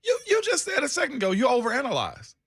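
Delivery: high, fast ribbing; excited teasing. Real, not acted. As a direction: rib him with a high voice. Teasing co-host about overanalyzing.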